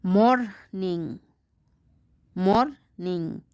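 Morning said slowly, MOR and ning apart; slow syllable split. Shown as a demonstration. Teacher modelling syllables to pupils, slow. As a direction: draw morning out in two beats. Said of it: "divide is odd in syllable".

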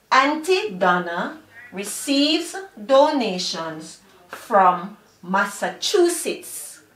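deliberate, word by word with small pauses; measured teacher's demonstration sentence. Shown as a demonstration. Teacher models a sentence for learners.

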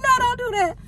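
high-pitched imitation of her mama scolding; mama's voice. Vlogger mimics her mom forbidding the gift money, high and squeaky. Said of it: "She was like".

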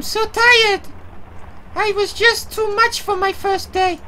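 lines voiced far above his own pitch; high character voice. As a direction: play the tired character in a high voice. Voicing a game character.

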